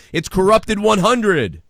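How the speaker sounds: slow, high and loud hype; excited announcement. Real, not acted. Host hyping the hundredth episode to listeners, excited. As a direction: announce it like a big milestone.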